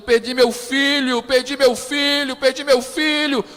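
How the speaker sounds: loud high wail, repeating her loss; grieving mother's cry. Retold or imitated. Preacher voicing a mother who lost her son, loud anguished lament.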